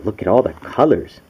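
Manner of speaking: ordinary delivery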